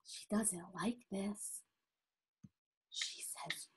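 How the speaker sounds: hushed, breath-only whisper; very soft stage whisper; whispered aside. Real, not acted.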